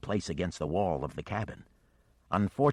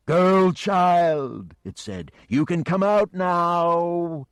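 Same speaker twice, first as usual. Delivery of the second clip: slow, much louder, higher luring monster voice; coaxing dragon; 11 semitones higher, 9 dB louder, much slower than usual. Acted. The dragon calls the girl child out of the cabin, luring. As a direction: lure her out like a patient predator.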